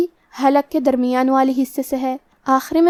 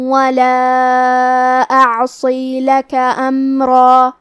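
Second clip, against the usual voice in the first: Quran recitation; much slower than usual. Acted. Teacher recites the verses for students, slow chant. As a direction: recite the verses slowly, melodic.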